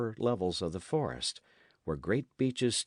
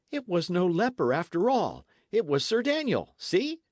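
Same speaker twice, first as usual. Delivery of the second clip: put-on high, eager boyish explaining; excited reassurance; 11 semitones higher, 6 dB louder than usual. Dick reassuring his frightened friend, excited and high. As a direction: lift the voice high and press the good news.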